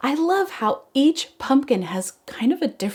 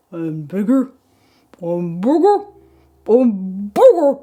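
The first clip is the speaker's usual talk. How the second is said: growing louder; 6 dB louder than usual. Shown as a demonstration. Pumpkin growing, voice building up.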